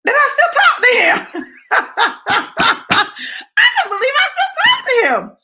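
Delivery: high, laughing shout of disbelief; disbelieving laugh. Acted. Comic telling audience about still talking to her ex, laughing high shout. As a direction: laugh it out loud, slowly, disbelieving.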